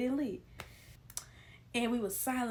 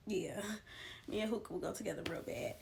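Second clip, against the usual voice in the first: whispered aside; 6 dB quieter than usual. Real, not acted. Confessing about hookah to viewers, laughing whisper. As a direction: say it under your breath like a secret joke.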